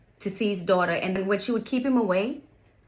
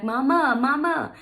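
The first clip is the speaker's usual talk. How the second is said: high, louder mock child's call; imitated child calling; 6 semitones higher, 5 dB louder, slower than usual. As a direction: call out like a little girl wanting mama. Stepdaughter calling her mama.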